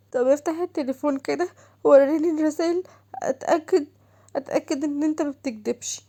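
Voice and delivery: wife ordering her husband to show his messages, high and loud; demanding wife. Acted.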